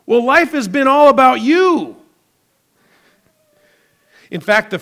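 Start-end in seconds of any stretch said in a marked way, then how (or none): 0.0-2.0 God rebuking. Retold or imitated. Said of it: "God says".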